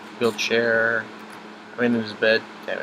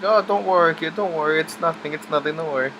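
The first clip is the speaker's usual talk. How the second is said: higher, louder lightly voiced grandpa brushing it off; grandpa reassuring; 6 semitones higher, 4 dB louder than usual. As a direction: play down the pain for the kid, a bit higher. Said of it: "He's like".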